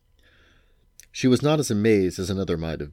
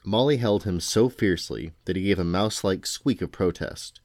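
usual speaking voice

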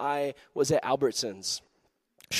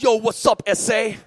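louder, higher street greeting; gangster; 4 semitones higher, 3 dB louder than usual. Retold or imitated. Big tattooed stranger greeting the preacher, gangster voice. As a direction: imitate a gangster hailing someone. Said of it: "straight up gangster".

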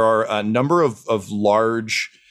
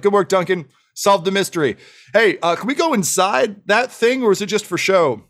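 high, eager gnome voice; brisk science gnome; 8 semitones higher than usual. Acted. Science gnome praises companion, asks to go inside, eager. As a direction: praise quickly, then ask with high-pitched curiosity.

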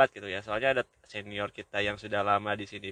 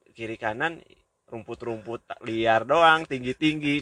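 ordinary talk, nothing marked

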